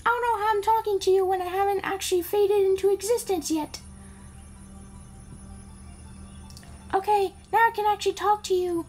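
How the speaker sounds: put-on high princess voice; Peach. Princess thanks Mario for saving her, put-on high voice. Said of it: "Peach is like".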